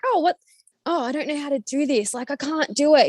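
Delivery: high, mock-helpless act; pretend clueless. Mum pretending ignorance so her son teaches her, high mock-helpless. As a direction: feign not knowing for the child. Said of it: "I act like I don't know something".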